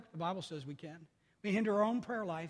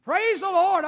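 joyful shout; 10 semitones higher, 13 dB louder, slower than usual. Real, not acted. Preacher to congregation, joyful shout of praise. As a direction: praise the Lord, loud and high.

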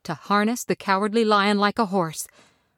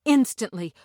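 plain reading voice

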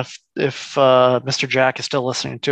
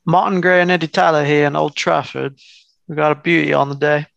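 mock British football commentator; British accent. Playing commentator at Old Trafford, British accent. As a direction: open the match broadcast in a put-on British accent. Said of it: "in a British accent".

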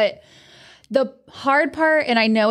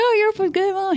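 cheery neighbor; 8 semitones higher than usual. Retold or imitated. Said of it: "he's like".

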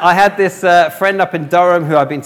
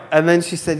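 normal talking voice

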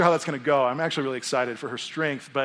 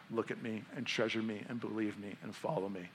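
soft, gentle invitation; tender; 5 semitones lower, 13 dB quieter than usual. Acted. Jesus inviting each listener softly, out of love. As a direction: speak low, out of love. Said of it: "Jesus, out of love, is saying to you".

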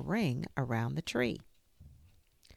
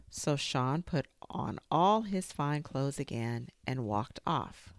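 ordinary delivery, nothing marked

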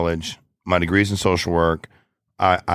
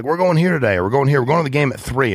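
imitating carefree buddy; 8 semitones higher, 3 dB louder, faster than usual. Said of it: "he's like".

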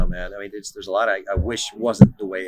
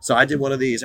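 ordinary talk, nothing marked

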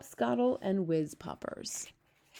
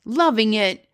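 very loud, higher-pitched exclamation; outraged cry; 4 semitones higher, 11 dB louder, slower than usual. A narrator's reading. Blood bottler answers the B F G, crying out. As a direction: throw it back in loud disbelief. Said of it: "cried the blood bottler".

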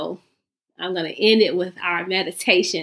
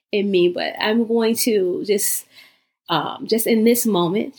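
ordinary delivery

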